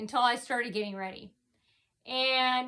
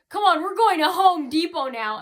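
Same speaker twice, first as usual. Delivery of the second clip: put-on loud, higher-pitched command to a child; imitated scolding order; 5 semitones higher, 8 dB louder than usual. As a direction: act out a woman ordering a boy along. Imitates woman dragging boy away.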